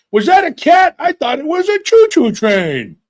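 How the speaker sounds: high, loud innocent excuse; clueless grandpa. Retold or imitated. Grandpa excusing the cat killing, high and clueless. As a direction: play dumb like a doddering old man.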